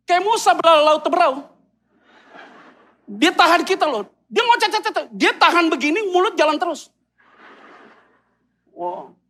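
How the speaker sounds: ordinary delivery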